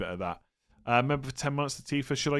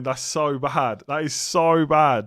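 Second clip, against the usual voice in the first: slow words stretched through a yawn; talking through a big real yawn; 8 dB louder, much slower than usual. Real, not acted. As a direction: drag it out mid-yawn, louder on the second. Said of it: "Oh my God, I'm yawning".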